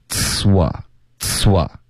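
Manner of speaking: "呲哇" as one tsswah; linked ts-w drill. Shown as a demonstration. Teacher drilling the join, "呲哇" without a break. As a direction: hold the hiss of "呲" straight into "哇". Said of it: "ts跟喔的音之间不要顿".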